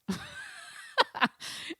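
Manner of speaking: airy unvoiced laugh; sheepish laugh at avoiding the word; breathy laugh. Real, not acted. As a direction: laugh at yourself. Laughs at dancing around word.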